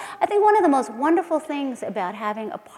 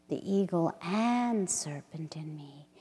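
whisper; 6 semitones lower, 10 dB quieter, slower than usual. Acted. Whispered line in poem.

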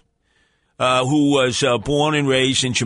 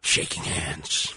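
a hint of a low, soft, slow lament; mock solemn; 11 semitones lower, 9 dB quieter, slower than usual. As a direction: sink the words into a mournful close. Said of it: "mournful close of rant".

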